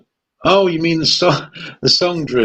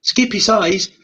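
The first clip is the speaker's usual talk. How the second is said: higher-pitched put-on Australian accent; mock Australian; 7 semitones higher than usual. Joking about Skippy with co-host, mock Australian accent. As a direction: play it as a silly Australian. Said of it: "kind of like northeastern Australia".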